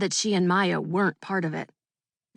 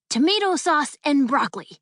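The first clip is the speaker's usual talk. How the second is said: higher, proud announcement; triumphant; 7 semitones higher, 3 dB louder than usual. A narrator's reading. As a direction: announce it like a victory. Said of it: "Harry said triumphantly".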